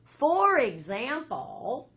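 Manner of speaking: very slow, stretched "For example"; drawn-out sentence starter. Shown as a demonstration. Teacher showing students how the next sentence starts, drawn out. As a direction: draw out "For example", syllable by syllable. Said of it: "will start like this".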